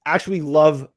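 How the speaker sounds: talking through hiccups; words broken by hiccups. Real, not acted. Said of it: "I have the hiccups".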